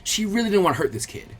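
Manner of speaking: plain reading voice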